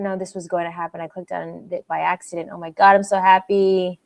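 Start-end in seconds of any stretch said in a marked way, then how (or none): none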